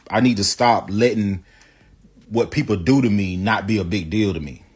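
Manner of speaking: ordinary talk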